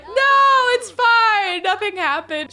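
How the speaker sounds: high, loud, hammed-up nothing happened; mock denial. Gamer voices her sim denying to the fiancé, hammed-up and high. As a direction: squeal it out like a guilty sim. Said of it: "be like".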